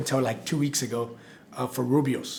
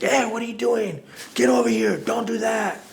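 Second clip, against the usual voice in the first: high, loud mock yell at a worker; emotional boss yelling; 9 semitones higher, 4 dB louder than usual. Stressed restaurant managers yelling at staff, mock angry yell. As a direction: bark orders like a stressed-out manager. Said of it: "when everybody's like".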